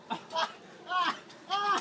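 after eating wasabi. Real, not acted. Mouth burning from wasabi.

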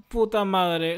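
ordinary delivery

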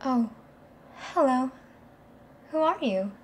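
put-on newly awakened girlfriend. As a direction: greet him as if waking up for the first time.

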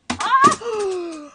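short high, loud "ah"; startled yelp. Real, not acted. Streamer caught off guard mid-stream, startled yelp. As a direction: yelp in sudden alarm.

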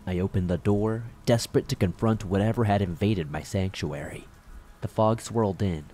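ordinary delivery

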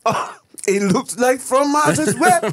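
put-on high, slow, laughing French accent; fake French accent. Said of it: "Fake French".

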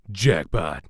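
bad American accent. Shown as a demonstration. Voice actor repeats Dante's line in a bad American accent. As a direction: say "Jackpot." like a cocky American action hero. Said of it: "This is a very bad American accent".

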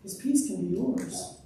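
ordinary talk, nothing marked

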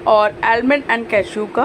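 usual speaking voice

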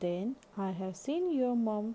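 high, loud surprised question; butterfly exclaims in surprise. A narrator's reading. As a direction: gasp it out as a discovery.

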